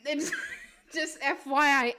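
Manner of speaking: ordinary delivery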